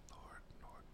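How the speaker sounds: whispered Lord, Lord in disbelief; exasperated whisper. Real, not acted. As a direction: breathe Lord, Lord out with no voice, fed up. Exasperated at the talking points.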